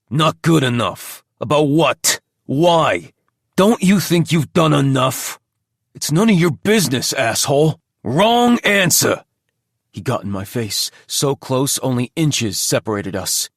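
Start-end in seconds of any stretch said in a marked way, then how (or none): 0.1-9.2 heated argument. A narrator's reading. Heated exchange at wall.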